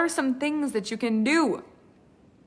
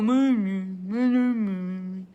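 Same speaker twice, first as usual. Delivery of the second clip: slow, droning miserable meh; lightly voiced gloomy whine; mopey meh; 4 semitones lower, slower than usual. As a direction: moan it like you've given up. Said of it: "when I hear people go".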